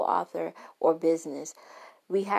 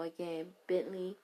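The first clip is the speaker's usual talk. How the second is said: normal talking voice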